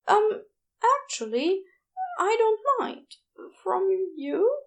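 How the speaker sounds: high, shy and hopeful; tentative, voiced well up high; Aziraphale's voice. A narrator's reading. Shy nurse admitting he likes the nickname, high and hesitant.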